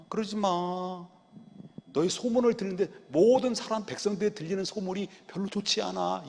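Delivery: soft, hushed, gentle coaxing; an old father softly begging his wicked sons; soft pleading father. Retold or imitated. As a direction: plead tenderly like a weak grandfather. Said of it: "이랬다는 거예요".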